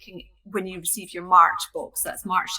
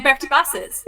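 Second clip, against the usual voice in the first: ordinary talk, nothing marked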